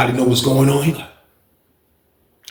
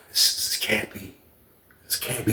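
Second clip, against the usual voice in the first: whispered, much softer disbelief; stunned whisper; 4 semitones lower, 13 dB quieter than usual. Retold or imitated. Dude thinking to himself in shock, whispered disbelief. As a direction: breathe it out in shock.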